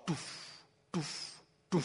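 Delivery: evenly spaced, muffled drumbeat sound; imitated drum pulse. Shown as a demonstration. Lecturer showing an audience how even a pulse induces trance, imitated drumbeat. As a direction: mimic a steady pulse with short thuds. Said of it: "that even a pulse".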